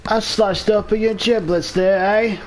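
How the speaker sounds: high, hammy fake British accent; a deliberately bad British accent, tossed off for fun; terrible British accent. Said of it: "That was a terrible British accent".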